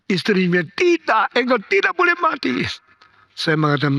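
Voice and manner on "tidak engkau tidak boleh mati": much higher, quick cry of refusal; desperate wife protest. Retold or imitated. Wife crying out to her dying husband, high and fast. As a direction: cry out against losing him.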